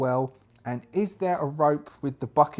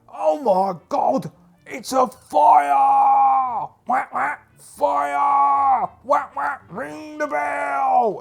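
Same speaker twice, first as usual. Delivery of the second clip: high, loud, slow panicked screaming; screaming fire alarm; 11 semitones higher, 7 dB louder, much slower than usual. Acted. Quackbane fakes panic to raise the alarm, screaming high and loud. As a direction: scream like the whole town must hear. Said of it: "as he's screaming".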